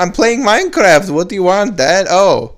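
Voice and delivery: high, loud mock foreign accent; swooping, hammed-up accented complaint; accented Ivanka. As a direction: whine it in a comic foreign accent. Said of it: "sound like a male with an accent".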